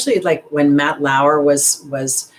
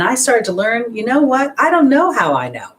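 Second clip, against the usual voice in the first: high, louder burst of realization; excited realization; 9 semitones higher, 4 dB louder than usual. Real, not acted. Telling viewers how she learned, excited and raised. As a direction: ring out the discovery, pitched up.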